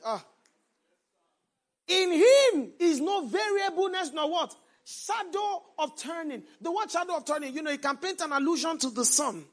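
rising, emphatic sermon delivery with loud peaks; full-force preaching. Acted. Preacher urging his congregation, loud emphatic preaching. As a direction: preach it out to the church.

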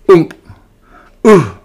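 loud pained grunts of a pig landing hard; grunting thump. Acted. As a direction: let out a winded grunt on impact. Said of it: "he grunted".

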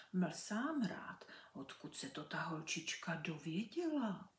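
whispered private thought; thinking aloud. Acted. Birlibán thinking to himself, whispered. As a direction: murmur it to yourself. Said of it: "pomyslel si Birlibán".

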